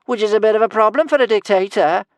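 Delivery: high, loud stream of taunts; teasing. A narrator's reading. Kamikaze insulting Norbert mid-fight, high loud teasing. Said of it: "teased Kamikaze".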